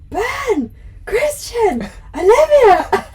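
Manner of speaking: high, loud call across a dark room; calling out names. Retold or imitated. Fan calling out to the band on stage, loud high shout. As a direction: yell the names from the front row. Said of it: "calls out from the front row".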